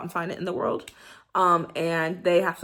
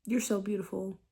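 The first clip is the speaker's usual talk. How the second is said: much softer tender praise; soft loving tone; 7 dB quieter than usual. Shown as a demonstration. Showing a lover's soft praise. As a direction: breathe it like a compliment in bed. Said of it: "imagine hearing".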